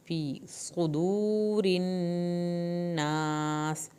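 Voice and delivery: slow chanted recitation over music; Quranic recitation. Shown as a demonstration. Teacher recites the verse to her students, slow and chanted. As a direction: sing it out evenly, stretching صدور. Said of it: "پیش کو لمبا نہیں کرنا".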